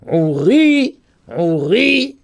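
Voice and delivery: "Ouri" with weight on final "ri"; stress on the last syllable. Shown as a demonstration. Teacher shows milra accent, "Ouri" stressed on "ri". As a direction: lean on the "ri" of "Ouri". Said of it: "il est mis sur le ri".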